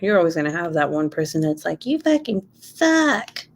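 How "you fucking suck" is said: high, slow, drawn-out jeer; mock hater. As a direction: imitate a heckler, pitched up and stretched. Voicing an online hater.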